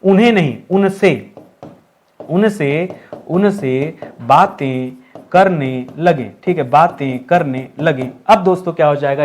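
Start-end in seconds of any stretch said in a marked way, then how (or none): 2.3-6.3 low, slow, every word drawn out; very slow dictation. Shown as a demonstration. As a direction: spell out the fix slowly for the class. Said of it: "क्या होना चाहिए?". (धीमे स्वर में, शब्द-शब्द करके)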